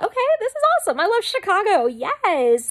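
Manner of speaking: very high, loud excited squeal; gushing, high-pitched celebration; thrilled. Retold or imitated. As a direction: squeal it with delight, settling slightly on the last word. Retells delight at going to Chicago.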